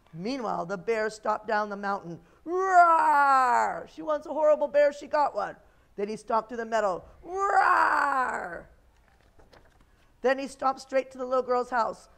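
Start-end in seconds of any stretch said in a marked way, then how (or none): 2.4-3.9 loud, long storybook roar; bear roar. A narrator's reading. Bear stomps down the mountain. 3.9-5.6 loud, fast, raised-pitch threat; vengeful bear. A narrator's reading. Angry bear sets off for revenge. 7.2-8.7 loud, high, drawn-out roar; bear roar. A narrator's reading. Bear stomps through the meadow.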